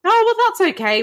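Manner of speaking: high, sing-song fake politeness; mock polite brush-off. To the magician, feigning it's fine in a high chirp. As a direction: chirp it like you're pretending not to mind.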